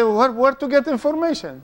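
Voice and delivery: high-pitched mock query; puzzled asking. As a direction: ask it high, as a puzzled caller would. Voices puzzled people asking for information.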